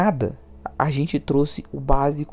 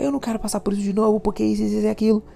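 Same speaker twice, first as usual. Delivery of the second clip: raised-pitch, quick mock of the wife's complaint; quoting the wife's complaint in a higher, faster mock voice; mimicking the wife; 8 semitones higher, much faster than usual. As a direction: rattle it off in a high mock voice as the upset wife. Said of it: "Aí ela começou a falar".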